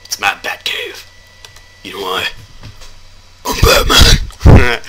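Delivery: mock superhero voice, ending in a loud, slow growl; playing the superhero announcing himself, building to a big finish; Batman. Player imagining himself as Batman in the caves, mock superhero. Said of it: "Batman and I was going into the caves like".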